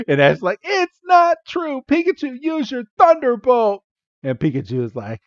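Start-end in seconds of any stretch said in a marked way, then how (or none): 0.6-3.8 much higher, slowish protest; high mock Ash. Ash flustered, calling on Pikachu in high mock voice. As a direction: squeal the denial like a kid.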